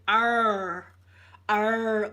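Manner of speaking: a failed r attempt, hammed up; imitating failed r. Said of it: "They could not do it".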